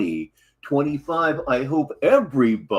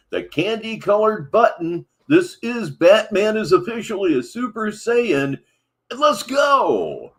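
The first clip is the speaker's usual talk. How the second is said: higher, louder hype-man build into the switch; hyped intro; 8 semitones higher, 5 dB louder than usual. Real, not acted. Host hyping his audience before switching to stream, excited. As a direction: pump up the crowd like an announcer. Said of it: "hypes up switching to stream".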